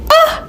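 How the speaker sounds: very high sudden yelp of shock; a high startled cry. Real, not acted. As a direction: gasp it out in disbelief. Shock at learning he's married.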